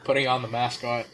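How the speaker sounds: normal talking voice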